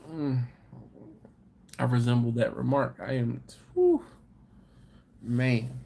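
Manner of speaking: usual speaking voice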